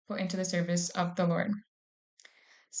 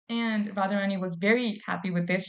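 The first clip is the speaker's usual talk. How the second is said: ordinary talk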